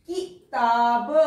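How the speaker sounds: drawn out slowly, each syllable apart; slow sounding out. Shown as a demonstration. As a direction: sound out किताब for the children. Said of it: "teacher sounds out word".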